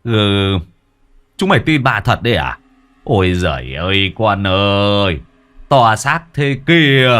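mock their fear like scolding kids; mocking boss. Boss Toàn scoffs at his scared workers, mock scolding.

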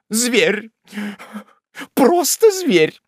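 high, drawn-out indignant exclamation; compassionate old woman. A narrator's reading. The landlady condemns the brother, indignant old woman. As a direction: cluck in outrage on the victim's behalf. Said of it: "отозвалась сострадательная старушка".

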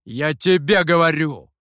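loud, forceful insistence; stern baritone. A narrator's reading. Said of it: "понизив и без того низкий баритон". (твёрдо и громко, баритоном)

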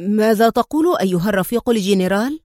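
plain reading voice

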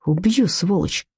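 usual speaking voice

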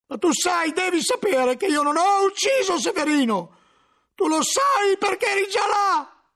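very high and loud frantic accusation; desperate shout. Acted. Cellarer accuses the librarian, desperate shouting.

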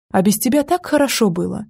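ordinary talk

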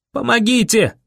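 high desperate cry; yelling for help. A narrator's reading. Boy yells on the run for help, yelling. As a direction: call out across empty streets.

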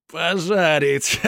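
high, slow laughing exclamation; drunken laughter. A narrator's reading. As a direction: burst out laughing at the joke. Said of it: "залился громким смехом".

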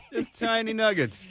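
laughing through the words. Real, not acted. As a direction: chuckle as you repeat it.

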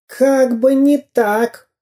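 high, slow jeering refusal; mocking spy. A narrator's reading. Dyrka refuses Karandash's plea, jeering. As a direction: drag out the scornful no.

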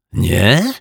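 scoffing. Acted. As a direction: snort it with disdain. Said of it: "prychnął pan Mietek". (prychnięcie z irytacją)

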